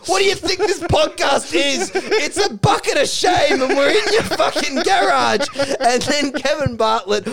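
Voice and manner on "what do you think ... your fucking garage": high, indignant shouting; outraged mock yell. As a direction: act out bursting in with a raised-pitch tirade. Rance bursts in, outraged.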